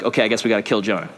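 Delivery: low, quick, reluctant giving-in; lightly imitated resigned sailors; mock resignation. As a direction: give in half-heartedly, under your breath.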